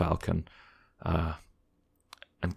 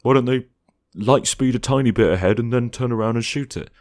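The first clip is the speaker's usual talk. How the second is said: mock nitpicking fan; 5 semitones higher, 3 dB louder than usual. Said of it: "you can go".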